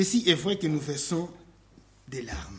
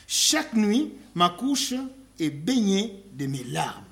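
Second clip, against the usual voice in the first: louder, fervent scripture reading; 3 semitones higher, 4 dB louder than usual. Real, not acted. Preacher reading Psalm six aloud, fervent. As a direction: proclaim the lament with force.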